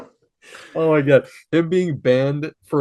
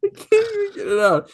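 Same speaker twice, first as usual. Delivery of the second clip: laughing; faster than usual. Real, not acted. Laughing too hard to speak.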